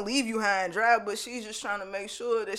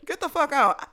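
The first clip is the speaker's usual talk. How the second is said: higher-pitched mock angry dismissal; mock harsh rejection; 6 semitones higher, 4 dB louder than usual. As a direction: act out kicking him out. Repeats the imagined rejection.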